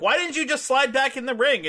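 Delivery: high, exasperated complaint; mock exasperation. Gene meets Suge mid-ring, mock-exasperated question.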